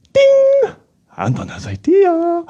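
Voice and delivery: high, slow puppet voice with a ding; puppet Anton. Acted. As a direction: chime then announce the idea in a high puppet voice. Puppet idea chime.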